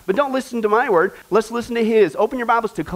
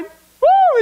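high, loud cheer; excited whoop; 6 dB louder than usual. Real, not acted. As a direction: whoop like a fan. Hyping up the men.

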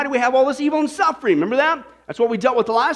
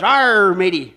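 loud, drawn-out "arr", then dropping lower; hammed-up pirate greeting; joke pirate; 3 semitones lower, 5 dB louder, slower than usual. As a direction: belt out the "arr" like a storybook pirate. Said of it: "And the pirate goes".

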